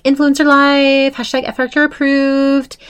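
high-pitched influencer posting voice; mock influencer. Mimicking influencers posting gratitude to Tanya, mock influencer. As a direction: imitate a gushing social media caption.

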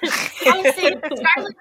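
slow smug boast; proud drawn-out boast. Retold or imitated. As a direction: say it slowly, full of pride. Voicing Winter's pride.

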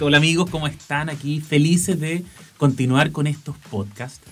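ordinary talk

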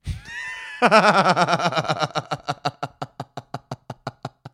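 fading belly laugh; real extended laughing fit; long rolling laughter. Real, not acted.

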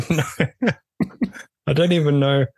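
usual speaking voice

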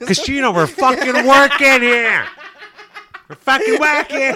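hammed-up yelling outburst; mock outraged yell. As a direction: bellow it through laughter. Sudden acted outburst mid-story.

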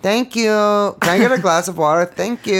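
whiny Kardashian voice. Retold or imitated. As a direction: toss off the thanks like a bored celebrity. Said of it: "Am I doing?".

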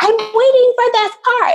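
imitated angry yell, very high; mock yelling. Freaked-out woman yelling from her car over parking, mock yelling. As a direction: shriek it like the freaked-out driver. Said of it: "starts yelling at me in her car".

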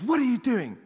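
high, loud angry policeman imitation; retold screaming. Policeman confronting a driver who ran the red, retold screaming. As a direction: yell it like an outraged traffic cop. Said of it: "he starts screaming".

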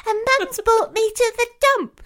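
high-pitched excited little girl, drawn into mock glee; excited child. Mum imitating her daughter at the dump, excited child voice. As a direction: squeal it like a thrilled child.

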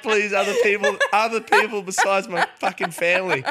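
laughing plea. Retold or imitated. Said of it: "I was like".

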